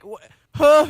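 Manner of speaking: loud, high attempted "huh"; student's puzzled go at a grunt; confused huh. Student copying the vocal teacher, loud high confused huh. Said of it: "And I'm like".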